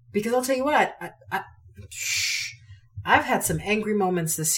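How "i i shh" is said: ordinary delivery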